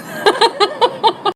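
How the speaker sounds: high-pitched giggling laugh; laughter. Real, not acted. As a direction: giggle high after your own story. Laughs after her own story.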